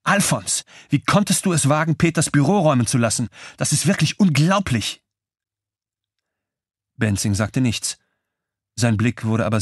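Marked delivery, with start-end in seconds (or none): 0.0-5.0 furious widow yelling at the manager; shouting angrily. A narrator's reading. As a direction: scream at him in outrage. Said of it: "schrie sie Benzing an".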